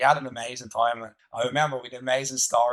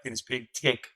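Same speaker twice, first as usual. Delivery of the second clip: ordinary talk, nothing marked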